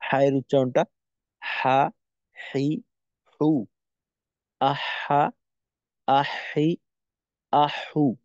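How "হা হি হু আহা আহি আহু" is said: হা, হি, হু with a breathy throat ha, syllable by syllable; Arabic ha drills. Shown as a demonstration. Teacher models the ha sound for students, syllable by syllable. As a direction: model each ha airy from the mid-throat. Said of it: "এভাবে আমরা হায়ের উচ্চারণটা করব".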